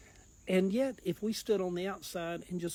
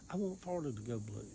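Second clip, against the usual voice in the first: normal talking voice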